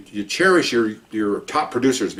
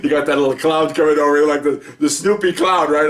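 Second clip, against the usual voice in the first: laugh, then carry on louder and higher; amused, laughing; 5 semitones higher, 4 dB louder than usual. Real, not acted. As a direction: chuckle your way into the remark. Amused aside after acted reaction.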